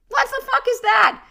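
high, loud, incredulous What the fuck; incredulous outburst. Real, not acted. Host scoffing at judging someone after few dates, loud incredulous outburst. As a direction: burst out in disbelief.

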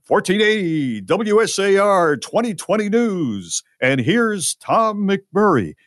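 high, drawn-out hammed-up station announcer; radio news announcer. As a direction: belt out a news intro like an old radio announcer. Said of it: "I hit the, uh, the sounder thing".

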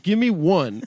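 loud, high insistent demand. Real, not acted. Demanding an impression from Nick.

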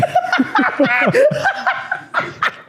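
high burst of laughter. Real, not acted.

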